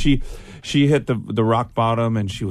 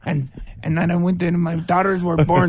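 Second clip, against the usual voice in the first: lightly put-on dad voice, higher; imitating friend's dad; 5 semitones higher than usual. Friend mimicking dad narrating the audiobook, lightly put-on voice. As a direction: have a playful go at someone's dad. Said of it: "can you do my dad's accent".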